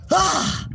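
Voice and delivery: high, sudden "ah"; sharp high exclamation; energized yelp. Acted. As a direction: let out a high coffee-jolt yelp. Acting out coffee energy jolt.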